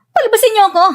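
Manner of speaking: high, desperate demand; shouting. Acted. Captive yells to be released, shouting. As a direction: call out to be let out. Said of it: "Huwag ka nang sumigaw".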